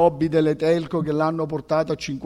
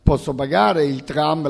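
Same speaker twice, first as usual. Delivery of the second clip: ordinary talk, nothing marked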